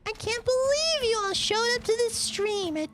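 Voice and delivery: very high put-on falsetto voice; squeaky falsetto demo. Shown as a demonstration. Streamer greeting late-night viewers, absurd high falsetto. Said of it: "you can find clips of me talking like this".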